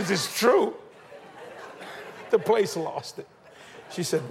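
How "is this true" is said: high, loud, laughing mock surprise; mock shocked question. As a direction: feign shock at your own confession. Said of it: "I said".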